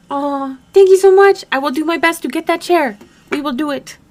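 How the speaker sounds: high, loud, eager gratitude; excited thanks. Real, not acted. Streamer promising viewers to get that chair, excited thanks.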